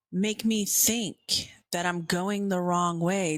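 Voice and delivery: normal talking voice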